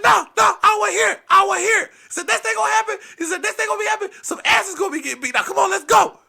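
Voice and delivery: high, loud, frantic shouting; furious grandpa rant. Retold or imitated. Grandpa threatening to tell on the kids, high shouted rant. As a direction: shout in a strained high voice, piling up complaints.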